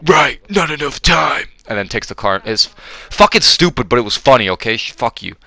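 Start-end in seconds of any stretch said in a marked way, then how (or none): 0.1-1.5 higher put-on action-hero voice; Cage impression. Cage grabbing the car from Yoshijiro, hammed-up impression. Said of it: "Cage is like".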